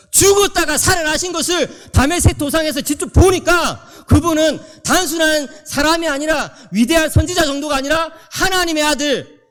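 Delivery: high, loud proclamation from the pulpit; full-force preaching. Real, not acted. Preacher tells how Paul met the risen Christ, forceful proclamation. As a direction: drive each phrase home to the congregation.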